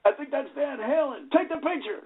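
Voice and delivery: high, hushed starstruck fan voice. Retold or imitated. Fan mistaking the band for Van Halen, high starstruck hush. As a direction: gasp it like you've spotted a rock star.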